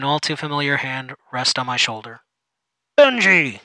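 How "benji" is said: loud, high, cheery call of his name; excited exclaim. Acted. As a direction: greet him with over-the-top glee. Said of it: "he exclaimed".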